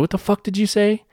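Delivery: high, incredulous retold comeback; indignant challenge. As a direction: act out demanding they repeat that. Repeats his angry challenge to her.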